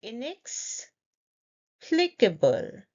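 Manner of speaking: "Inexplicable" slowly, pause after "inex"; in two parts. Shown as a demonstration. Teacher leading say it with me, slow in parts. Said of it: "say it in parts".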